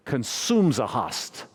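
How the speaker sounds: slow, imitated Croatian accent with the words run together; rough imitation of a Croatian priest's accent; thick Croatian accent. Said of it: "I can't do a Croatian accent, but it was thick".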